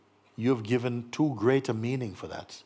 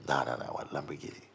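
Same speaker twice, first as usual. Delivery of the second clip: fast, low, soft brush-off; dismissive refusal; 8 semitones lower, 5 dB quieter, much faster than usual. Acted. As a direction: rattle off the refusal as a stubborn seeker. Acted seeker refuses peace again.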